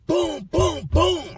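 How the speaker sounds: high punchy boom boom imitation; door kicks. Inmate imitating kicking his cell door. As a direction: mimic heavy kicks on metal.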